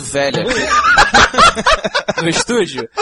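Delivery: laughing. Real, not acted. Laughing tease.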